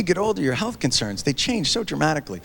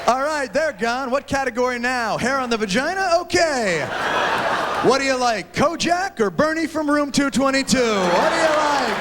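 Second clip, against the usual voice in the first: higher host patter; game show host; 6 semitones higher than usual. Retold or imitated. Comic playing the dating show host, higher. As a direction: hype it like a TV host. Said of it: "dating show parody".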